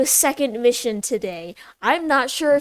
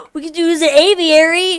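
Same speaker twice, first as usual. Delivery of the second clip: gasps, then bursts out loud; thrilled outburst at the discovery; excited gasp; 5 semitones higher, 9 dB louder than usual. Real, not acted. Gets excited seeing the flyers.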